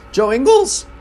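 real voice crack on the name, jumping high; accidental squeaky crack mid-name. Real, not acted. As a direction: let the voice break upward on Ingles. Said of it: "excuse my voice crack".

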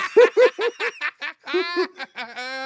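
a long, high burst of laughter; hearty real laughter; big laugh. Real, not acted. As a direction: let the laugh roll out. Laughing at Murdoch's switch.

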